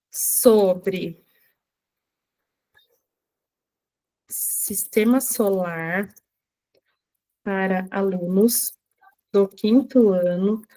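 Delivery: slow, word by word, as if typing; slow dictation. Shown as a demonstration. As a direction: dictate each chunk slowly with pauses. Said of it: "nós vamos colocar assim, ó". (ditando devagar, palavra por palavra)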